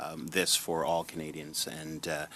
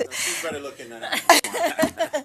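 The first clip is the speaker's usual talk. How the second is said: laughing through a quip, pitched high; a playful, giggly joke; 6 dB louder than usual. Real, not acted. Senator jokes back after being called the wrong name, laughing.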